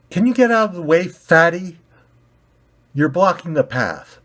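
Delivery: high, loud rude jab; rude demand. Acted. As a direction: push them aside with words. Red ball rude.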